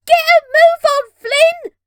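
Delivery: high, loud urgent cry; shouting. Acted. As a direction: yell it across the deck. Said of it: "cries Red".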